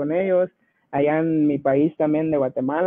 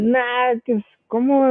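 pitched-up, startled "what?"; surprised disbelief; 6 semitones higher, slower than usual. Retold or imitated. Telling Rudy he can't be serious, mildly startled. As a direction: caught off guard by the idea, asking back in disbelief. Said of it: "le digo yo".

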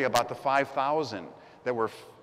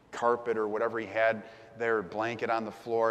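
ordinary talk

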